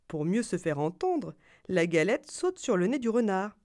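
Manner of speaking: ordinary delivery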